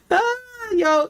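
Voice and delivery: high burst of real laughter. Real, not acted.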